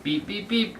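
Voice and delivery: playful beep beep; truck horn beeps. Acted. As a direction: honk it like a toy truck. Truck horn in read-aloud.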